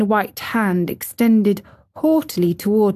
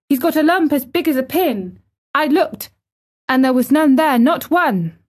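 high, heated defence; indignant protest, pitched up; fierce indignation; 7 semitones higher than usual. A narrator's reading. Girl calls up the wall defending him, indignant. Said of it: "almost shouting up the wall in fierce indignation".

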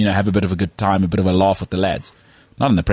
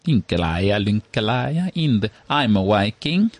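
sing-song mock Danish accent, slow at the end; slower than usual. Mocking how Danes talk, in a sing-song Danish accent. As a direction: lilt up and down like a cartoon Dane. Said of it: "They talk like this".

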